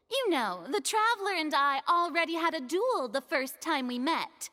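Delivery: usual speaking voice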